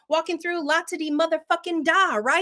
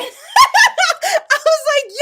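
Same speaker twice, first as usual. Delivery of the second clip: big burst of high laughter; loud laugh; 10 dB louder than usual. Real, not acted. Loud laugh at being recognised.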